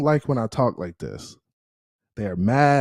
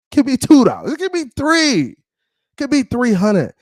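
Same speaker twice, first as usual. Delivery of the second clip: high-pitched, excited escalating calls; hyped-up urging; 11 semitones higher, 3 dB louder than usual. Real, not acted. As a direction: push the bids up, voice climbing high. Hyping up viewers for donations.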